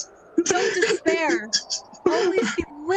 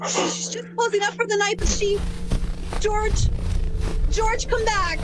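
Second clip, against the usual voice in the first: calling out; 8 semitones higher than usual. Acted. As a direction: call him back in alarm. Clarence calls after fleeing George.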